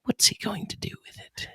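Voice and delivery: breathy nature-show whisper. Retold or imitated. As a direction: whisper it like you're hiding from the animal. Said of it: "the lower voice where he's whispering".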